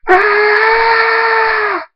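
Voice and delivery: long, sustained very high scream. A narrator's reading. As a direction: let out a drawn-out anguished scream. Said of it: "Draconic screams".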